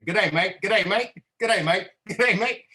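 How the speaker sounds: cheerful mock Australian accent, rising in pitch; Australian greetings. Australian partners popping into the call, playful Australian accent. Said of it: "watch 'em all pop in. Like".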